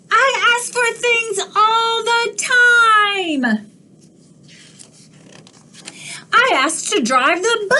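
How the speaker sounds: high, quick cartoon pigeon demanding answers; a nosy, pushy bird; squawky pigeon grilling the duckling. Pigeon rant.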